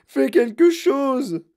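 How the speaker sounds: très aigu, lent et fort; cri suppliant. Acted. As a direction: implorer en criant. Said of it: "cria madame Gloop". (la mère supplie son mari, cri aigu et lent)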